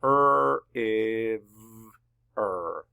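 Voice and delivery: "Er, if, er" as drawn-out separate sounds: rr, ivv, er; sounded out. Shown as a demonstration. Teacher sounds out river for class, "Er, if, er" segmented. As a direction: stretch each sound of "Er, if, er" with pauses. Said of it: "easily sound it out now".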